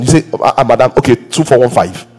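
usual speaking voice

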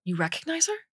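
high, sudden startled question; blurted in surprise. Acted. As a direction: blurt it out, caught off guard. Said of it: "I blurted".

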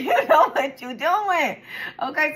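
high, loud, drawn-out tease through laughter; teasing laugh. Real, not acted. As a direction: stretch it out, laughing knowingly.

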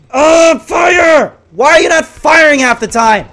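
high, loud exasperated yell; frustrated shout. Real, not acted. Frustrated at gun not firing.

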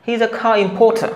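high "car importer" as khaa im-pho-tha; linked slow "He is a car importer", puffed consonants, r's dropped; natural correct pronunciation. Shown as a demonstration. Teacher repeating the natural version, "car importer" aspirated without r. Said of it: "এটা বললে কতটা natural শোনায়?".

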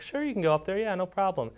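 high, friendly sing-song yes; cheerful helper. Retold or imitated. Friendly staff ladies to shy boy, a high cheerful yes. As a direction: chirp it like a cheerful staff lady.

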